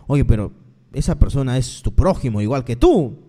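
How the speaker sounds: low, admonishing aside; voice of conscience. Retold or imitated. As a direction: pitch it down as an inner conscience speaking up. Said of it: "tu misma conciencia te va a decir".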